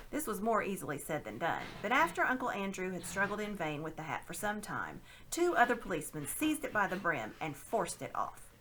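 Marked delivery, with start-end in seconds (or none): none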